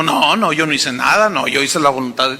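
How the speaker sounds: a hint of whiny Saúl brushing off blame; mock self-justifying Saúl. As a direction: mutter the excuses like a guilty king dodging blame. Saúl excusing himself, mocked.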